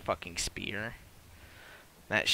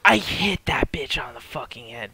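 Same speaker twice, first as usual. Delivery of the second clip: excited whisper; 7 dB louder than usual. Real, not acted. Excited hushed boast.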